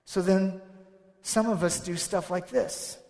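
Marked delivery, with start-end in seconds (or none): none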